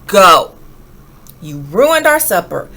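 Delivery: loud scolding dad sending her away; angry father. A narrator's reading. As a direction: bark the order at the child. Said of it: "Her father said".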